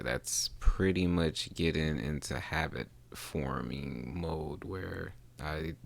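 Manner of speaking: normal talking voice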